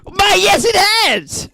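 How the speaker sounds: very high, loud grand villain voice; a mad hero booming at his nemesis; hammed-up Guardian. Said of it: "pretending to be insane".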